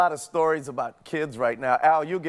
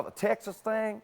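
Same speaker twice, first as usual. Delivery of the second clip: lightly put-on Texas accent, softer; 4 semitones higher, 7 dB quieter than usual. Shown as a demonstration. Showing the producers' options, mock Texas accent. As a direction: try out a mock Texas twang. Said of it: "like that".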